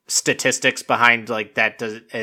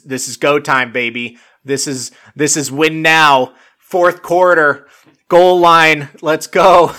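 coach pep talk; 3 semitones higher, 6 dB louder, slower than usual. Retold or imitated. Coach sending the kid out at goal line, rousing pep talk. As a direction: build to a mock sideline rallying shout. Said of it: "like, the coach, like, telling the kid".

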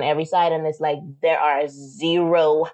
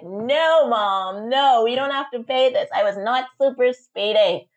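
higher-pitched pleading refusal, retold; exasperated protest; 6 semitones higher, slower than usual. Daughter telling mom not to pay, exasperated. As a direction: protest emphatically, easing down toward the end.

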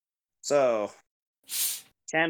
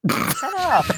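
playful shout; 4 dB louder than usual. Real, not acted. Playful retort to teasing.

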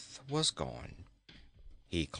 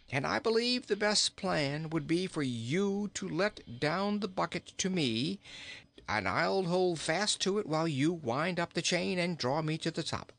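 high-pitched storybook king explaining a plan; jolly king; 6 semitones higher than usual. Acted. King tells the boy how to haul him up, high-pitched. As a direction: lay out the plan in a high king's voice.

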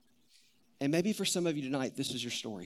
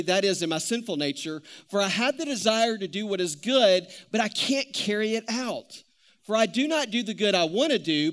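steady, raised preaching read of the passage; scripture reading; 4 semitones higher, 5 dB louder than usual. Acted. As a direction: read the verses out to the congregation. Reads Romans aloud.

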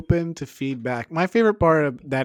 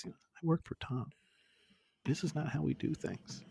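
much softer, mumbled imitation of a shy boast; sheepish quiet mention; 3 semitones higher, 9 dB quieter than usual. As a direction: slip it in quietly, embarrassed. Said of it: "he just kind of very quietly says".

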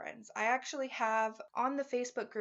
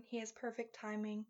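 ordinary delivery

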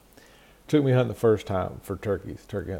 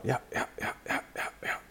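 imitated turkey call; 3 semitones higher, 8 dB quieter, faster than usual. Imitating his box call.

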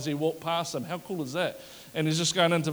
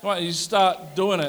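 normal talking voice

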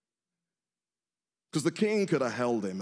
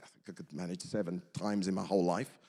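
mock reasonable Peter; 7 semitones lower, 5 dB quieter than usual. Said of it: "He's like".

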